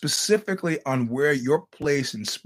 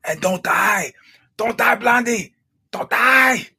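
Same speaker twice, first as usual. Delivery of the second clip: shouting; 6 semitones higher, 8 dB louder, slower than usual. Acted. Loud sign-off to listeners.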